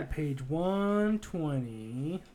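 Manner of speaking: very slow, drawn-out page announcement; slow, hammed-up game instruction; put-on reading voice. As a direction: stretch each number out with mock weight.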